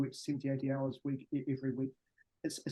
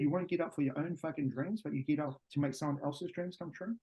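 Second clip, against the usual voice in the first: ordinary talk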